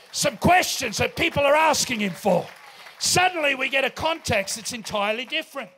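loud preaching build; preaching at full force. Acted. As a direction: press the point home to the room. Preaching with music behind.

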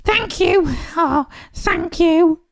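very high-pitched witch voice; grateful cry. A narrator's reading. Witch thanking friends who saved her, grateful cry. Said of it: "the grateful witch cried".

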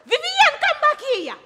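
high, exasperated call; bossy, high-pitched summons; calling after someone. Acted. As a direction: call her back as she walks off. Calling after a sister walking off.

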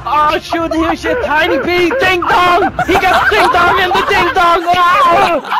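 loud, high hammed-up sportscaster; play-by-play commentary. Mock sports commentator calling a kill on air, loud and hyped. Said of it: "top of the range Call of Duty commentary".

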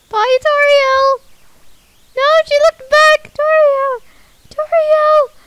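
high, loud call, stretched out. Real, not acted. Player shouting goodbye to Toriel who looked back, high and loud. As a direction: shout after someone leaving.

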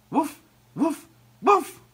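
high woofs building loud; loudest dog barks. A narrator's reading. Ebb calling to the lone seal, barking loudly. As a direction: bark with all your might. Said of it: "barked as loud as she could".

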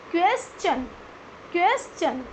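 क्वेश्चन in two beats, "kwes - chun"; correct pronunciation. Shown as a demonstration. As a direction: clear "ch" in क्वेश्चन after a break. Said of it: "क्या होगा?". (क्वेश्चन को "क्वेस-चन" बोलें)

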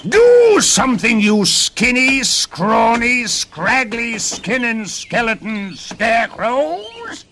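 very high shouted tantrum, words stretched out; shrieking storybook dwarf in a rage; raging little man. A narrator's reading. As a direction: explode at the top of your range, dragging out each insult. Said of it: "hopping and jumping in rage".

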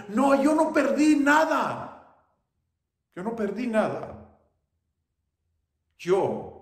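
high, loud proud declaration, easing as it goes. Acted. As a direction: proclaim it, then let it settle. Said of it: "alzó la voz".

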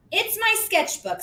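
high, defensive little voice; embarrassed girl. A narrator's reading. Susie explaining her sketchbook, high and flustered.

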